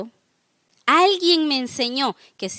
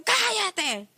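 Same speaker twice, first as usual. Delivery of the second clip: high, drawn-out mock shout; mock yell; 5 semitones higher, 5 dB quieter than usual. Someone yelling back at a person who shouts, mock yell. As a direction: imitate yelling back, pitched up without real volume. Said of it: "le grito más fuerte".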